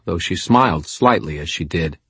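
ordinary delivery, nothing marked

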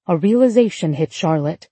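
ordinary delivery

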